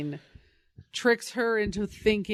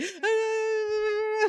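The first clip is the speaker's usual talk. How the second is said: long, high comic wail; Simpsons-style mock scream; 14 semitones higher than usual. Likening Bruce to a Simpsons bit, high imitated scream. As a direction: imitate a drawn-out cartoon scream. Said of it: "it's like that Simpsons where he's like".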